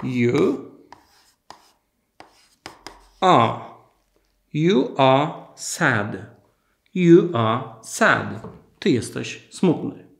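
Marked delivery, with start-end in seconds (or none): 0.0-8.3 slow, carefully spaced words for a class; slow model sentence. Shown as a demonstration. Teacher to Polish students, slow clear model sentence. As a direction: say it slowly so learners can copy. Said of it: "Pamiętacie, jak jest ty jesteś?".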